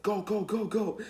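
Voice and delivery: high, loud getaway yell; urgent shouting. Retold or imitated. Teller urging his friend to drive off, urgent shout. Acted urgent order to flee.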